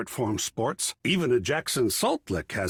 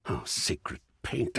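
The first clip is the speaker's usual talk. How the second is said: hushed, slow and softer; awed whisper; 11 semitones lower, 6 dB quieter, slower than usual. Acted. Marvelling about the art journey, whispered and slow. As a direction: breathe it out in quiet awe. Awed exclamation under the breath.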